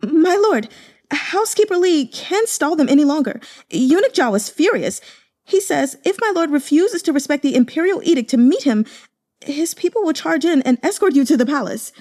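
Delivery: high, loud breathless servant voice; panicked report. Acted. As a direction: rush the news out in a panic.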